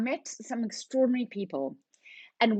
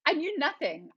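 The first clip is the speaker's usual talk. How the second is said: ordinary delivery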